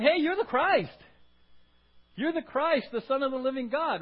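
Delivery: high, loud, thrilled declaration; excited Peter. Pretend. Peter shouting his revelation to Jesus, high and excited. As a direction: blurt it out like a sudden discovery.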